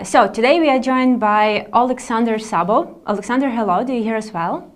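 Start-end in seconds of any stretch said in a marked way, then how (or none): none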